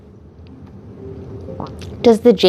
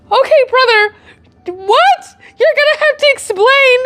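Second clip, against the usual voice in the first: incredulous sass; 13 semitones higher than usual. Retold or imitated. Retelling her response to boyfriend John, high sassy disbelief. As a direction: demand an explanation, half laughing. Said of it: "I was like".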